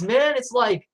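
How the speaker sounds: ordinary talk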